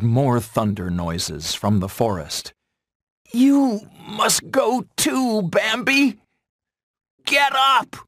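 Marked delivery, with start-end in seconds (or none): 3.3-8.0 very high-pitched fawn cry. A narrator's reading. Young deer urging a fallen friend onward, high crying out. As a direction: urge it out high and drawn. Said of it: "cried Bambi".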